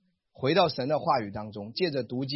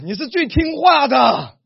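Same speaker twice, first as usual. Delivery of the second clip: slow, high, shouted praise; booming declaration to a child; loud praise; 10 semitones higher, 11 dB louder, slower than usual. Shown as a demonstration.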